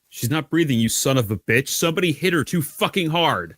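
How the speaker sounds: acted outrage, pitched well up; desperate protest. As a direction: plead angrily for an injured friend. Captive acts desperate outburst at guard.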